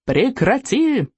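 high, slow mock yell. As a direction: shout the order to stop. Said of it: "завопил Икинг".

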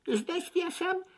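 high, startled little chick; gasping realisation. A narrator's reading. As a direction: gasp it in sudden dismay. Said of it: "ахнул Фип".

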